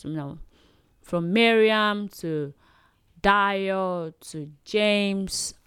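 usual speaking voice

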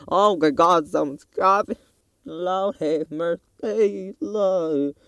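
high, loud panicked cry, then drawn-out wail; hammed-up dread, pitched high and dragged out; mock panic. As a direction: melodramatic panic at someone coming near. Acts out panic in the shop.

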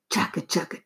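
"Chugga, chugga" breathed in a voiceless whisper. A narrator's reading. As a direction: whisper "Chugga, chugga" like a distant train. Train sound, whispered take.

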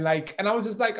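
ordinary talk, nothing marked

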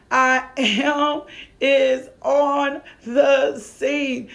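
"I am is on the scene" very slowly, each word stretched and stressed; emphatic declaration. Real, not acted. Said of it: "You emphasize that".

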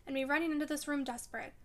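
plain reading voice

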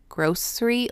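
grocery with a plain S, GROHS-ree; own pronunciation. Shown as a demonstration. Co-host showing how they say the word, s-sound grocery. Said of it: "I say grocery".

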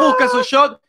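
very high, loud hype call; rally the viewers; squealing party host; hyped. Acted. Hyping viewers to grab a shot.

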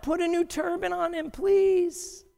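high pleading cry; a prophet who can't hold back, crying out high; pleading scream. Acted. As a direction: beg at the top of your voice. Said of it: "the prophet screams out".

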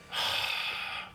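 long, airy exhale; long sigh. Retold or imitated. Man in the booth giving in, long resigned sigh. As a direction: let out a resigned breath.

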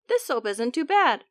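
ordinary delivery

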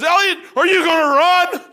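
high, loud shout across the yard; a superior calling out from far off; boss shouting. Retold or imitated. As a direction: yell down from the second story. And he goes.

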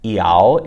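"iao" said loud, ee gliding into froggy ao. Shown as a demonstration. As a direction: start "iao" on ee, then move to froggy ao. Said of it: "it has one extra vowel".

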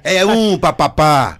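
loud, drawn-out game-show chant; catchphrase performance. Shown as a demonstration. Guest redoes his pie-throwing bit on request, shouted catchphrase. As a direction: belt it out slowly and punchily. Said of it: "como é que era aquela".

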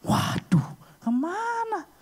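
sudden high shocked cry; shocked disbelief. Retold or imitated. At the shop counter, a high shocked cry over the missing money. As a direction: gasp out where the money went. Card says insufficient funds.

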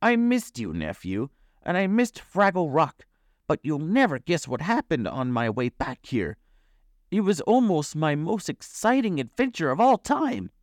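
high, animated character voice; high-pitched, excited boasting; storytelling uncle. A narrator's reading. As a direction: recount it as a grand adventurer. Uncle grandly tells his adventure.

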